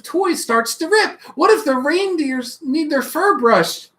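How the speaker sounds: put-on eager little child calling Mama; a child pestering with questions; small child full of what-ifs; curious child.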